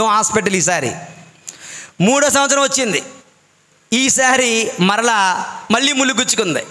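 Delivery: ordinary talk, nothing marked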